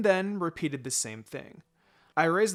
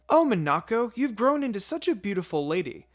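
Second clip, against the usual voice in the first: higher, louder, gushing mother's welcome; high, delighted motherly greeting; gushing older woman; 7 semitones higher, 7 dB louder than usual. Acted. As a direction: coo over her like a proud auntie. Mother greeting a grown girl.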